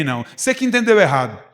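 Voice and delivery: normal talking voice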